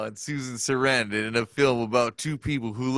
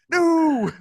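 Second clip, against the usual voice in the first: much higher, loud cry of dismay; horrified shout; 8 dB louder than usual. Retold or imitated. Watching the pipe bowl drop in the pool, shouting. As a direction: yell it as the bowl falls.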